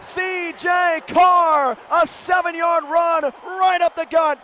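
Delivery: usual speaking voice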